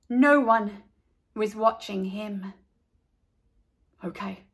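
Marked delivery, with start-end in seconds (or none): 4.0-4.5 hushed, much softer okay; whisper. A narrator's reading. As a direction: keep it secret from the others. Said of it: "he whispered to Carla".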